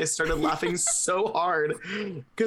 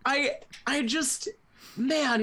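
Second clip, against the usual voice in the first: ordinary talk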